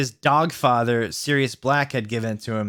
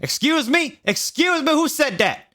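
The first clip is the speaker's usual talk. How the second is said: very high, loud outraged yelling; indignant janitor yell; 3 dB louder than usual. A narrator's reading. Janitor yelling at the hidden voice, very high and outraged. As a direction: shriek it like an offended janitor. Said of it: "yelled Mister Norris".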